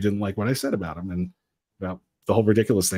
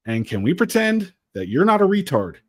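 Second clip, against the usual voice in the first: sarcastic retort; 7 semitones higher than usual. Retold or imitated.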